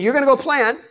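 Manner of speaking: high, loud booming declaration; preacher voicing God, loud and high; God's loud promise. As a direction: proclaim it like God promising plenty. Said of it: "He's saying".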